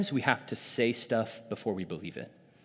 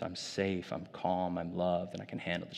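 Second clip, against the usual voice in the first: softer, gentle coaching voice; soft reassurance; 5 semitones lower, 8 dB quieter than usual. Retold or imitated. Katie guiding panicking niece through the truths, soft. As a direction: coax a frightened child calmly. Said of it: "And she's like".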